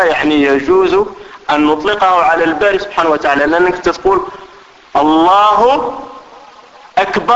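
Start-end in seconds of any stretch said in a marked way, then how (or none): 5.0-7.3 very slow الله, then أكبر as "akbaar"; long alif in akbar. Shown as a demonstration. Teacher showing why it means drum, أكبر stretched to "akbaar". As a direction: draw out الله and lengthen the end of أكبر. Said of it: "قلنا الطبل".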